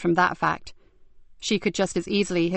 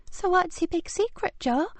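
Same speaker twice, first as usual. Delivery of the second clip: sleepy mutter; 11 semitones higher, 4 dB quieter than usual. Acted. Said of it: "muttered a sleepy voice".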